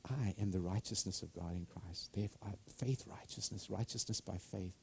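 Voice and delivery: a hushed, awed statement of faith; hushed declaration. Real, not acted. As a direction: speak it softly like a quiet confession.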